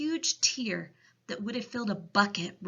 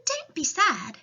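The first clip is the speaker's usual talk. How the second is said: put-on high little girl voice; high child's voice, consoling; Sophie; 10 semitones higher, 4 dB louder than usual. A narrator's reading. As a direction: speak up as a small girl. Sophie comforts the giant.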